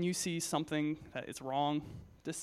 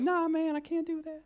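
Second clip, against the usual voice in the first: homeless man's voice; 13 semitones higher than usual. Retold or imitated. Youth speaker imitates homeless man's greeting, high put-on voice. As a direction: pitch way up like the homeless guy greeting you. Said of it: "That's actually what he sounded like".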